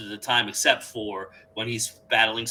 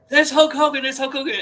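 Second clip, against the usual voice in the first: much higher, louder excited announcer call; Dusty Rhodes excited; 11 semitones higher, 8 dB louder than usual. Retold or imitated. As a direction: call out the hero's arrival with big excitement. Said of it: "you hear Dusty Rhodes and everybody go".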